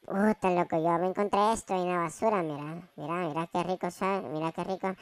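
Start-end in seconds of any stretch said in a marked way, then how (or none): 2.4-4.9 soft lower mumble while munching; munching and humming with pleasure; eating trash. Acted. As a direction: savour it with mm sounds. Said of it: "no comas eso".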